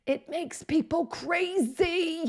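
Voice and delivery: high, slow, drawn-out exasperation; exasperated. Real, not acted. Web designer venting about a changed UI, exasperated. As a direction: stretch it out high, driven up the wall.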